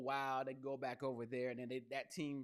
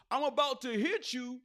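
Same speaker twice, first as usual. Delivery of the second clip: higher, louder mock fighting threat; 9 semitones higher, 6 dB louder than usual. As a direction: imitate a player talking big before a fake fight. Mocking players' fake fight threats.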